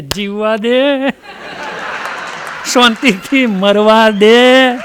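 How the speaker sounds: worn-out husband begging for peace; fed-up plea. Acted. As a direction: drag it out in exasperation.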